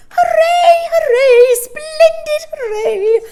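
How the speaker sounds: very high, very loud shrill cheer; shrill little cry. A narrator's reading. Old woman dancing and cheering shrilly. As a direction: cheer in pure glee. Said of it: "she cried in her shrill little voice".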